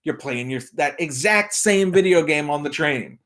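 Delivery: usual speaking voice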